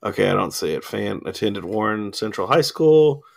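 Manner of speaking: ordinary delivery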